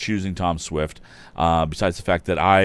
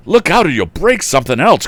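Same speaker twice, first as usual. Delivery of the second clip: high, loud warning shout; alarmed cry; 11 semitones higher, 11 dB louder than usual. Acted. Lad on the rear seat warning Andy, loud cry. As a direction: yell a warning from the back seat. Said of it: "cried a lad".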